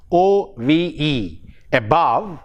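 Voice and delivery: usual speaking voice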